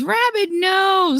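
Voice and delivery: high, slow drawn out no; mock protest. Real, not acted. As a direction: stretch the no up high.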